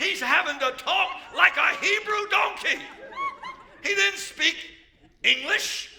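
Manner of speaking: high, loud amused preaching; excited laughing. Real, not acted. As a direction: laugh your way through it, delighted. Preacher laughing at donkey story.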